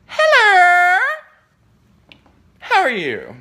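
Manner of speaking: very high, loud, long-held hello; shrieked greeting. Pretend. Imagined surprise visit to a building, shrieked greeting. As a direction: screech the greeting in mock triumph. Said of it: "And I'm gonna say".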